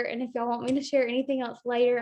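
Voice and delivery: usual speaking voice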